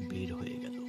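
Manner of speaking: ordinary delivery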